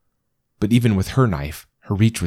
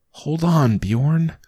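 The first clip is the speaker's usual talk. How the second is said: pleading; 9 semitones higher, much slower than usual. Acted. Pleading with dying dog.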